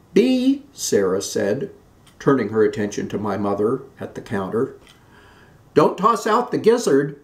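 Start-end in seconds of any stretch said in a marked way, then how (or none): 0.1-0.6 much higher, loud call; high call for Bea. A narrator's reading. Calling sister-in-law at counter. 5.8-7.2 much higher and louder, urgent; high, urgent call across the kitchen. A narrator's reading.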